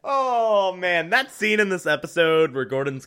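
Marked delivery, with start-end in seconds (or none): none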